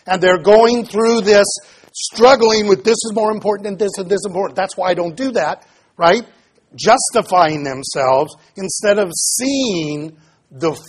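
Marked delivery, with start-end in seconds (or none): 2.8-5.7 very fast, higher-pitched mock excuse; rattled-off self-justification. Preacher voicing Pharisees justifying themselves, rapid mock excuse.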